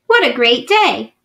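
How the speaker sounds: ordinary delivery, nothing marked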